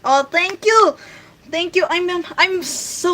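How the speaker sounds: high, loud happy thank you; bright excited gratitude to the chat; delighted thanks. Real, not acted. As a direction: gush your thanks to a compliment. Viewer calls her funny.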